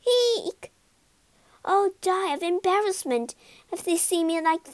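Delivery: high squeal then a fussy lady ghost; shriek, then fret about being seen; squeaky ghost lady. Acted. Ghost lady shrieks at her wig.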